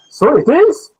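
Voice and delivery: So it is said high and slow; stress and high rise. Shown as a demonstration. Teacher modelling So it is for the students. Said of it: "It's a question".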